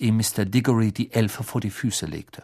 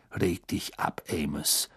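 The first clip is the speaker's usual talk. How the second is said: low, much softer, calming; quietly; 6 semitones lower, 9 dB quieter than usual. Acted. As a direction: settle an angry friend down softly. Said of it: "sagte Mister Weasley leise".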